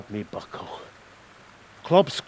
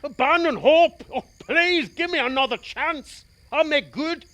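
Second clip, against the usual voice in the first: high, pleading cry for one more chance; desperate begging; 11 semitones higher, 5 dB louder than usual. Acted. Frightened man begging for another chance, high and desperate. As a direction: plead for your soul, voice up high.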